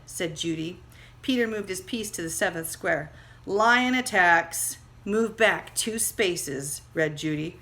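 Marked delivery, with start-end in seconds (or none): 3.4-6.8 slow and heavy; slow dramatic. Acted. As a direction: read it out slowly with weight. Reading dramatic instruction.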